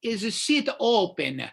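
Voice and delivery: exaggerated Italian-accented pidgin English; broken English. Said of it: "and in broken English".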